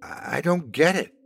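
frustrated and at a loss, pitch lifted. A narrator's reading. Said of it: "She was growing more exasperated".

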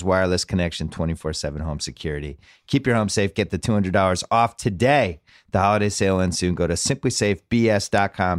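brisk podcast ad read; holiday sale ad. Acted. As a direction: read the sponsor spot upbeat. Sponsor reads before the show.

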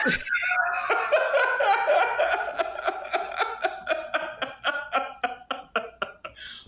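big laugh. Real, not acted. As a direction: burst out laughing at your own joke. Laughs at her own joke.

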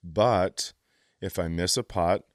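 ordinary talk, nothing marked

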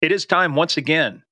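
pumped-up show host intro; hype announcer. Acted.

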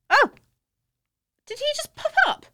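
sudden high startled cry. Real, not acted. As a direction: jump at a sudden fright. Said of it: "That was scary".

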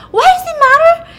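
very high, loud mock outburst; hammed-up freak-out. Drunk woman cornered by a neighbor's questions, hammed-up freak-out. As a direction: blow up as the panicking heroine. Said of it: "she starts freaking out".

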